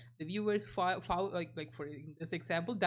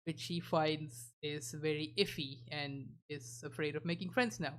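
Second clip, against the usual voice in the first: ordinary talk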